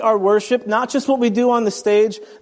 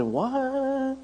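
surprised what; 6 semitones higher, 8 dB quieter than usual. Retold or imitated. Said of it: "She said".

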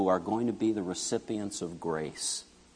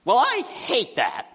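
high, slow mock pout of a daughter in a snit; high childish pout; imitating bratty daughter; 11 semitones higher than usual. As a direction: whine it like a frustrated kid. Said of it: "she goes".

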